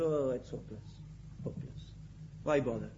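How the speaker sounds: low, soft and defeated, lightly acted; mock despair. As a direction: sigh it out as if giving up. Congregant's imagined despairing self-talk.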